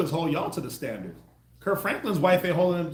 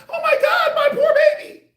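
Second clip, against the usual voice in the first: very high, loud laughing coo; mock doting mom; 10 dB louder than usual. Mocking an emotional mother coddling her boy. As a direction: shriek it like an overwrought mother. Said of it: "you come with the emotion".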